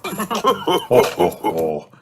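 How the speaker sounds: a hammed-up ho ho ho chuckle; chuckle, pleased with yourself; chuckling. Father pleased after mending the dinosaur, light chuckle. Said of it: "chuckles Daddy Pig".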